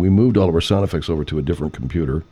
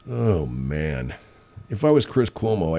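ordinary talk, nothing marked

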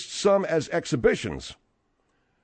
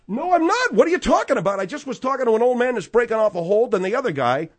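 excited; 8 semitones higher, 4 dB louder than usual. Real, not acted. Heated denial in argument.

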